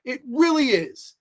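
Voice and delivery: ordinary delivery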